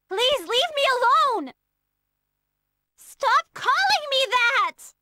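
high, slow, drawn-out complaint; whiny protest. Acted. Girl begging the other to stop calling her that, whiny protest. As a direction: stretch the plea out in a sulky protest.

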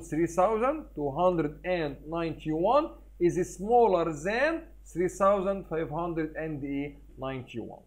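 slow read aloud. Shown as a demonstration. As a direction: read the sentence out slowly to the kids. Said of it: "نقول ايه".

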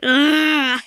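frustrated cry-groan into a laugh; whiny groan. Real, not acted. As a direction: groan half-crying, half-laughing.

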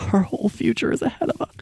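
giddy rush. Real, not acted.

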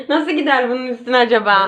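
ordinary talk, nothing marked

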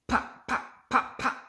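unvoiced popping sounds; cork pops. Reading to the child, popping like a cork. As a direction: pop like a cork. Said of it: "He can sound like a cork".

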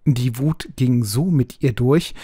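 ordinary delivery, nothing marked

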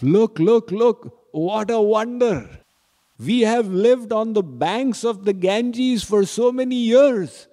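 high, eager calling out; bursting with wonder, calling his wife; excited. Acted. Kewat calls his wife to see the wonder, high and excited.

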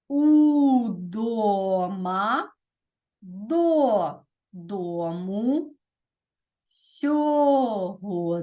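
each word drawn out very slowly, syllable by syllable; slow dictation. Shown as a demonstration. Teacher revealing the solved anagram words to the class, slow and drawn out. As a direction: stretch every word for pupils writing it down. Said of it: "Ось такі слова".

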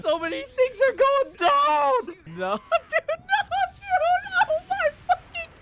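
very high, frantic excitement; overwhelmed squealing. Real, not acted. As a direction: lose it completely, laughing and squealing. Overwhelmed by the episode.

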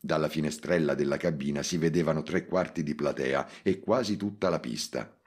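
usual speaking voice

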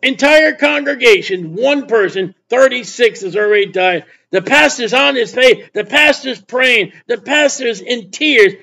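urgent, repetitive sermon cadence; emphatic preacher driving it home; forceful preaching. Real, not acted. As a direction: build the case phrase by phrase.

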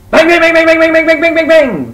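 very loud, high rapid-fire bangs; machine-like burst of high, shouted bangs; banging noise. Acted. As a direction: hammer the word out loudly, high-pitched. Acts out the woodpecker banging.

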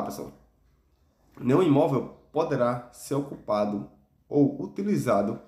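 ordinary delivery

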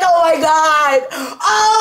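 drawn-out, rising squeal of excitement; excited screaming. Real, not acted. Showing the reaction to this pack, screaming. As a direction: let it build into a scream. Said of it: "I'm, like, screaming".

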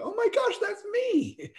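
very high, squeaky gasp of recognition; mock amazed. Presenter imitating someone reading their own assessment, squealing. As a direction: play a gushing test-taker amazed at the results. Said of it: "You go".

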